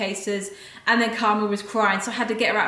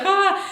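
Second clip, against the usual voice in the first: panicked "ah"; 10 semitones higher than usual. Retold or imitated. Retelling her panic on the train, to viewers: high panicked "Ah". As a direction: let out a sudden high panicky "Ah". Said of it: "I was just like".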